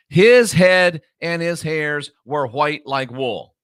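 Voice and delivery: slow, spaced-out scripture reading; deliberate reading. Shown as a demonstration. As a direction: read it out slowly, phrase by phrase. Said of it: "Let's read it without the added words".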